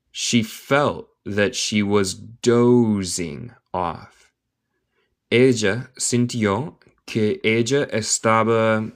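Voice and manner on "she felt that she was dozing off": slow reading. Shown as a demonstration. Slow repeat for learner.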